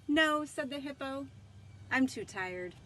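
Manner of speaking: lively storybook read-aloud with voiced characters; reading to children. Librarian reading Dragon aloud to children, animated.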